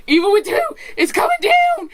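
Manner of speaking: climbing to a very high, loud, teary squeal; ecstatic squeal. Retold or imitated. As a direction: build into a shriek of joy.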